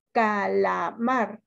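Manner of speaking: "Calamar" very slow in separate syllables, MAR marked; stress on MAR. Shown as a demonstration. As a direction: sound out "Calamar", landing on "mar". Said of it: "¿Cuál es su sílaba tónica?". ("Calamar" muy lento y separado, marcando MAR)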